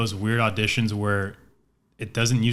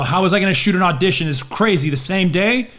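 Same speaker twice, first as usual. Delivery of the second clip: imitating upset James; 7 semitones higher, 4 dB louder than usual. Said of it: "he was just like".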